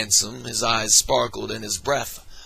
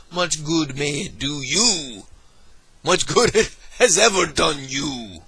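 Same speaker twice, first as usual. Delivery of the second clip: sour sarcasm; 5 semitones higher, 4 dB louder than usual. Acted. Scrooge's sour retort.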